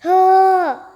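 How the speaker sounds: a sudden loud, high oh; loud outburst. Real, not acted. Player yells as the game goes wrong, loud outburst. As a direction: blurt it out in frustration.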